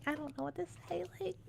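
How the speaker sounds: breathless embarrassed whisper. Acted. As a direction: whisper it, too flustered to speak. Shy in-character reply to confession.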